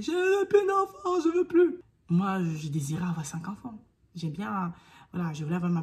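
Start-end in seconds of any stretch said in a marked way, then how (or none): 0.0-1.8 high, loud slow cry; yelled refusal. Retold or imitated. Mother after birth crying out to everyone, yelling. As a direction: scream you want no more kids. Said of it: "j'ai crié".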